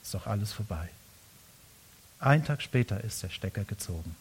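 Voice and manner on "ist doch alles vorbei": soft, low, defeated; resigned sigh. Retold or imitated. Elia giving up before God, a soft low resigned murmur. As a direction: let it drop, all fight gone. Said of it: "Und jetzt sagt er".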